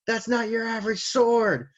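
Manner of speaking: high-pitched, hammed-up alarm; warning cry. Recapper as Big Mom warning about the blade, mock alarmed.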